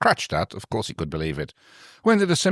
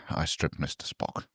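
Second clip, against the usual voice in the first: hushed, softer confessional whisper; 5 semitones lower, 12 dB quieter, slower than usual. Acted. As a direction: whisper it like a guilty secret. Repeated punchline of story summary.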